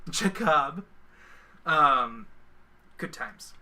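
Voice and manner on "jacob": "Jacob" as juh-KOB, rising at the end; mispronounced name. Pretend. Streamer echoing his misspelt name, "Jacob" as juh-KOB. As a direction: say "Jacob" with the stress moved to KOB.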